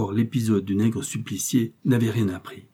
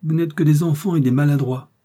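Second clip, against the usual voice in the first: higher, louder mocking cry; jeering, raised voice without actual laughter; amused exclamation; 6 semitones higher, 5 dB louder than usual. A narrator's reading. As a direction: exclaim as if laughing at bungling underlings. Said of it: "en riant, il s'écria".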